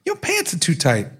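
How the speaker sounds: a touch of a mocking girl's voice; mimicked girlfriend. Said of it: "he mimics a high-pitched female voice".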